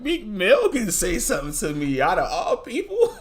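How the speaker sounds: high, loud words squeezed out through laughter; real laughter breaking the quote; laughing through the words. Real, not acted. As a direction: quote him while cracking up.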